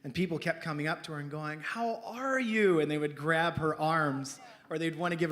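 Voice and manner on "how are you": high, drawn-out caring greeting; slow, sing-out sympathy as you lean in; gushing concern. Retold or imitated. Church friends greeting the wounded wife, gushing concern.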